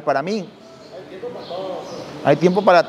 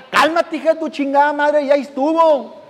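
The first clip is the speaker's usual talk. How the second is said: higher, angry cursing; exploding at wife; 7 semitones higher than usual. Retold or imitated. Husband finally snaps at his nagging wife, angry. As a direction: lose it and curse her out.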